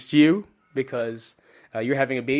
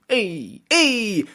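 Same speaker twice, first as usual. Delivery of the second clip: 1 New Jersey Italian; 6 semitones higher, 3 dB louder than usual. Retold or imitated. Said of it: "big difference between New Jersey".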